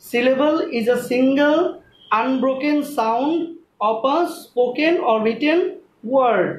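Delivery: very slow dictation, phrase by phrase; drawn-out, deliberate teacher delivery; slow repeated definition. Shown as a demonstration. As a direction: repeat it slowly with pauses between phrases. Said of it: "আমি আবারও বলছি".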